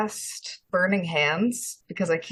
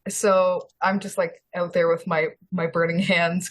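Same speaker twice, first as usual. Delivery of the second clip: ordinary talk, nothing marked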